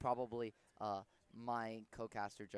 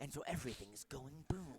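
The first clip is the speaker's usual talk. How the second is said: Snake impression. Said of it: "I do a Snake impression".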